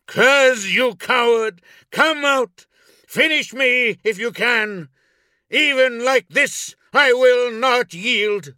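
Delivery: very high, loud angry challenge; defiant bellowing. A narrator's reading. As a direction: shout defiance at a hidden enemy. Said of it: "bellowing at the shadows".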